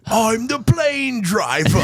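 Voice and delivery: high, slow shouted boast; mock action hero. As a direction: bellow it like a goofy hero.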